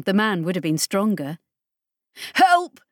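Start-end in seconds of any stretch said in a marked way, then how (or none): 2.3-2.8 high, loud cry for help; desperate shout. A narrator's reading. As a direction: yell it out helplessly. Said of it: "he said ridiculously".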